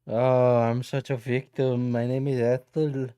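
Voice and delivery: mock the critic as a self-pitying victim. Streamer mocking a critic, hammed-up victim voice.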